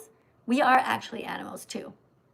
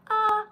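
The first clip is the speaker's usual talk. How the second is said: put-on baby "ah"; 10 semitones higher, 4 dB louder than usual. Baby opening mouth for food.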